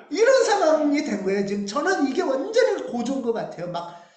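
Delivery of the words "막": usual speaking voice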